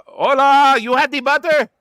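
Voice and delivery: high, loud hammy Spanish voice; mock Spanish. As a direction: squeal it in fake Spanish. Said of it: "can you say it in Spanish".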